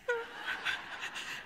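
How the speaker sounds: breathy unvoiced mock laugh; imitated laugh. Lee stands laughing as the group laughs, imitated breathy laugh. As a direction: act out Lee laughing silently. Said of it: "there's Lee standing there like this".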